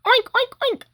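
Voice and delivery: high squeaky pig oinks; piggy oinks. Pretend. Reader voicing the pigs for young listeners, high squeaky oinks. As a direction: squeal it like a little cartoon pig. Animal sounds in picture book.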